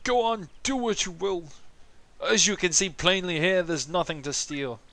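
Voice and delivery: high-pitched, put-on elderly voice; senile old man. Old Koopa tells supposed thieves to go on, senile voice. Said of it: "He's very senile".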